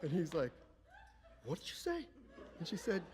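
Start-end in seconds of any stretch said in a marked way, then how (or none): 1.4-2.1 high, soft, incredulous question; affronted disbelief. Retold or imitated. Father challenging his mother-in-law's insult, affronted. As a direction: imitate a father stung by an insult.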